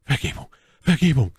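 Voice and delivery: bewegter, höherer Ausruf; flehend ausgerufen. A narrator's reading. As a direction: um Verzeihung flehen. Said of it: "rief Felton". (flehentlich rufen)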